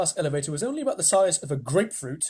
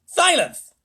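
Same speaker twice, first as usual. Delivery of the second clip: loud high order; commanding; 11 semitones higher, 9 dB louder than usual. Acted. The President silencing Showler, loud. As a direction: cut them off. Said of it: "said the President".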